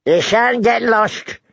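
put-on high, slow old man's grumble; querulous complaint. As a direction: complain like a crotchety old lord. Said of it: "Lord Walter complained".

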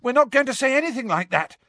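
high, fast urgent protest; Harry shouting. A narrator's reading. Boy protesting to the centaurs, urgent mild shout. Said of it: "Harry shouted".

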